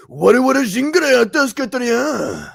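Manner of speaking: hammed-up anime villain challenge; anime final boss. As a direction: bellow fake Japanese like a boss before a fight. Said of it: "imitate the, like, the final boss".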